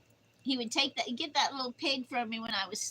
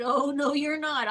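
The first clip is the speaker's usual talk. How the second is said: loud, higher flat refusal; retold loud no to the friend; 4 semitones higher, 10 dB louder than usual. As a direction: shut the offer down hard. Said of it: "And I said".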